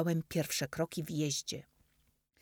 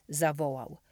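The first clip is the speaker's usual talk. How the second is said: usual speaking voice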